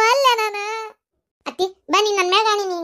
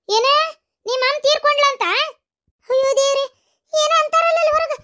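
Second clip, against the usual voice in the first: higher, stunned question; shocked cry at the news of a death; 5 semitones higher than usual. Acted. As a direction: gasp it out in disbelief. Hearing of a mother's death.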